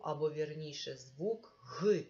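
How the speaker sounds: normal talking voice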